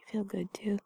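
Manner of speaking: soft cozy whisper. Acted. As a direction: murmur it close and tender. Held whisper.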